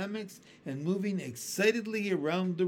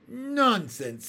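dismissive; 7 semitones higher, 7 dB louder than usual. A narrator's reading. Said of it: "said the ladybug".

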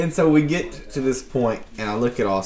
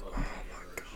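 whispered grumble under the breath; hushed mock exasperation; imitating friend's mumbling; 20 dB quieter, much faster than usual. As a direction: mutter it barely audibly, retold. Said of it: "hear him in the back mumbling".

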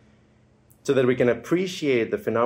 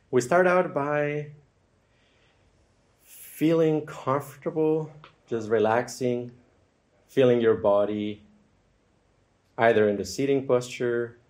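unhurried meditation guide with long, settling pauses; guided meditation. Acted. Guide leads listeners into feeling comfortable and relaxing, guided meditation. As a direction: ease listeners gently into noticing the body.

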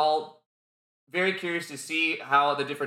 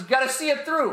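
higher, punchy hype voice; high energetic; 10 semitones higher, faster than usual. Shown as a demonstration. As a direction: pitch it up with excitement. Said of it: "I'm really loud and obnoxious".

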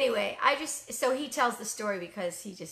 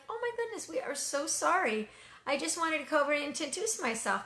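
much higher, rushed apologetic gush; apologetic surprise; 6 semitones higher than usual. Retold or imitated. Apologizing to upset neighbor.

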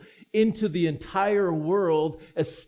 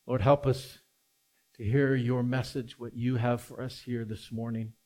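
prayer; 6 semitones lower, 6 dB quieter than usual. Acted. Pastor prays before sermon.

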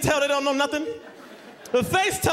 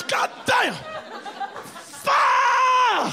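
straining shout; 9 semitones higher, much slower than usual. Retold or imitated. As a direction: heave against the door and yell it out. Straining at heavy door.

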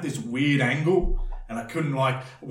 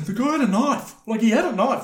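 high, loud panicky cry; panicked blurt; 11 semitones higher, 5 dB louder than usual. Retold or imitated. Telling the police, high loud panic. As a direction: blurt it out, frantic. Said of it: "That's all I could say".

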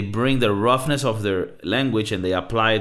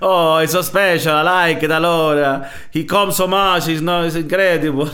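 high, loud mock Italian accent; hammed-up, high-pitched Italian-English; Italian girl; 7 semitones higher, 5 dB louder than usual. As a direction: gush like an amazed Italian lover.

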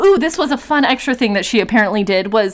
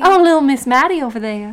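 put-on Southern lilt, a bit higher; light mock Southern accent; 3 semitones higher than usual. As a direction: gossip like a Southern church lady.

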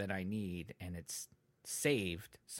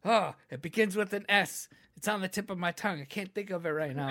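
higher-pitched exasperated groping for a word; frustrated word-searching; 8 semitones higher, 6 dB louder than usual. Retold or imitated. Podcaster re-enacting a forgotten word, mock frustration. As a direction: replay your own flustered tip-of-the-tongue struggle. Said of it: "when I was trying to say the word sarcasm, but I couldn't think".

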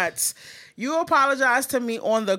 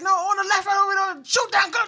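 gamer yelling callouts; 10 semitones higher than usual. Retold or imitated. Mock gamer shouting callouts at his girl on the phone. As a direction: shout directions like a gamer mid-match. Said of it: "He'd be like".

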